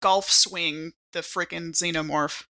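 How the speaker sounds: normal talking voice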